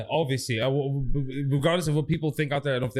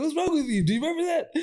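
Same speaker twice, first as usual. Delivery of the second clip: mom scolding; 11 semitones higher than usual. Retold or imitated. Mom scolding the possessed auntie.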